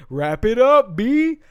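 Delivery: high, drawn-out holler; big drawn-out catchphrase shout; playful yell. Pretend. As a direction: yell it loud and high, stretching the words. Cuts in with a yelled catchphrase.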